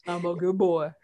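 lightly voiced dumb pup; big dog voice. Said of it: "every voice that we give our bigger dogs".

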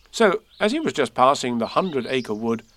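ordinary delivery

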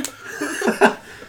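high nervous laugh; high mock-stressed laugh. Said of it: "With correct impressions".

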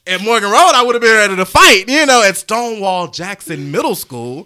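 loud, high fired-up claim; loud brag. Real, not acted. Boasting about old school toughness, loud and high. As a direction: puff up about home turf. Brags about fighting back home.

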